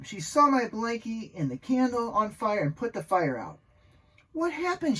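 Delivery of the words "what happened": high-pitched worried question; alarmed question. A narrator's reading. Mother rushing in to Max after the fire, alarmed question. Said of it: "she asked".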